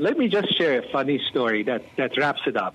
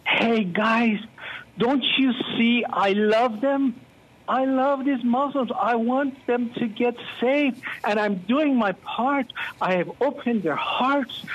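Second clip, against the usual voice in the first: much higher, pleading and proclaiming; God proclaiming; 9 semitones higher than usual. Retold or imitated. God calling Christians in the West to share the gospel, proclaiming much higher. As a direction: call out to the Western church like a loving voice from above. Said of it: "shouting and proclaiming".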